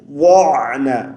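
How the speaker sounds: وَعْنَا with a thick, heavy ض; heavy dad sound. Shown as a demonstration. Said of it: "موٹی آواز سے پڑھیں گے".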